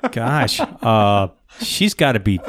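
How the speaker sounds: ordinary talk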